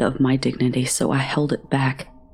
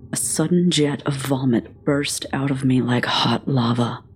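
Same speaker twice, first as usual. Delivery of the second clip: plain reading voice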